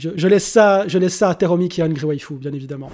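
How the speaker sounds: usual speaking voice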